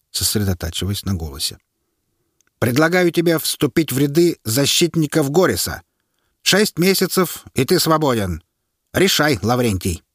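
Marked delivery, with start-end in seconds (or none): 2.6-10.0 high, firm offer; officer's offer. A narrator's reading. Officer offering the prisoner a way out, firm. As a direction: put the offer firmly to him.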